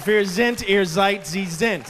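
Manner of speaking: loud, emphatic German recitation. Acted. Wisecracking employee conjugating at the boss, in German. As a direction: rattle off a mock German conjugation. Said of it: "Then I went German".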